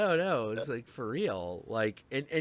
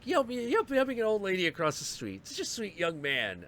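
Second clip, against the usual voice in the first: put-on much higher, doting old lady voice; 8 semitones higher than usual. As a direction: gush like a grandma praising a boy. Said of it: "And she's like".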